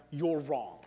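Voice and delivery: ordinary talk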